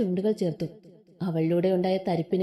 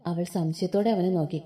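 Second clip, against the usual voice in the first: ordinary delivery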